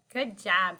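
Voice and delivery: normal talking voice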